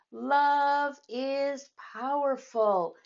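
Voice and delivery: a slow, drawn-out call of Love is powerful; mild yell. A narrator's reading. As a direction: call it out slowly over the crowd. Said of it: "she yelled the message".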